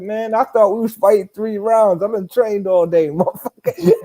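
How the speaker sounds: laughing complaint. Retold or imitated. Boxer quoting his complaint to the opponent, laughing. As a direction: protest through a laugh.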